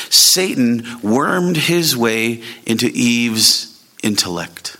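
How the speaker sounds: drawn out slowly, weighing each word; slow deliberate reading. Shown as a demonstration. Pastor reading Nettles' quote to the church, slow and deliberate. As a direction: read the quote slowly to let it sink in. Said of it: "He writes this".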